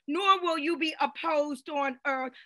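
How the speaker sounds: usual speaking voice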